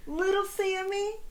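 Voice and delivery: high, sugary wake-up coo; mock gentle parent; exaggerated gentle parent. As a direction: coax a child out of bed too sweetly. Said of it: "I love the next one".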